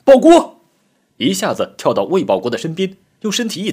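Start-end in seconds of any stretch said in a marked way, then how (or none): 0.1-0.6 sudden high, full-force shout of a name; urgent loud cry; shouted warning. A narrator's reading. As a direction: yell to a comrade in danger. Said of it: "吴志军喊了一声".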